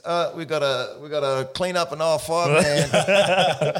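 high, loud announcer-style alarm; panicking nervous system. Retold or imitated. Nervous system announcing a cleanup, loud high comic alarm. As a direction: call a cleanup in aisle five in a panic.

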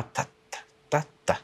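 soft, spaced ta-ta shot sounds; imitated distant gunfire. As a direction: imitate faraway shots in quiet syllables. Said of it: "ירי כזה מרחוק". (חיקוי שקט של ירי מרחוק)

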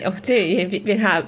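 usual speaking voice

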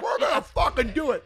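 high, loud, laughing pep cry; rally everyone to pull it off; excited girl power. Retold or imitated. Rental clerk cheering them on while loading beer, excited and loud. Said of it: "the girl from Enterprise is excited now".

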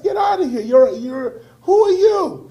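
high, loud mock brush-off; an imitated Harvard crowd shouting a man away; dismissive shout. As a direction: yell him out of the room. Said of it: "they told Doctor Cornel West".

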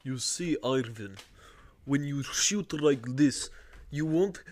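slow, heavy mock Russian; drawn-out Russian caricature; Russian accent. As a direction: explain it deliberately in a thick Russian accent. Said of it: "hear your Russian accent".

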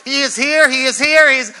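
high, loud outburst of ecstatic praise; prophesying warriors. Acted. Warriors suddenly start prophesying, ecstatic shouting. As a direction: burst out in mock prophetic fervor. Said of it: "They start prophesying".

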